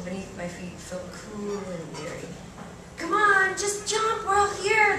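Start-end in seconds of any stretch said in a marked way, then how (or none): 3.0-5.0 much higher shout up from the water; retold calling shout. Boys in the well urging her to jump, high calling shout. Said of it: "they shouted".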